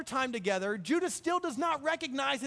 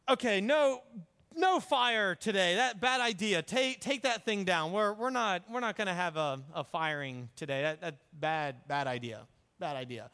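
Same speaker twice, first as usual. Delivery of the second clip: flustered backpedaling; 3 semitones lower than usual. Retold or imitated. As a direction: call it off awkwardly, voice steadily dropping. Judah hastily calls off the burning.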